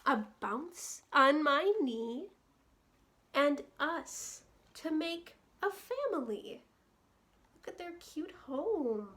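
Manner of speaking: gentle, lilting read-aloud for little ones; bedtime story reading. A narrator's reading. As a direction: read it like a cozy bedtime book. Reading picture book to children.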